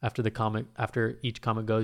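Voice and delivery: ordinary talk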